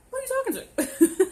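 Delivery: very high-pitched, loud comic indignation; playful high-voiced "who, me?" challenge; mock outrage. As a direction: squeal it in disbelief, about to laugh. Mock outrage at being snapped at.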